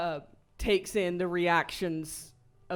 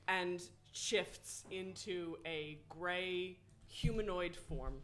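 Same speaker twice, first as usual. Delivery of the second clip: usual speaking voice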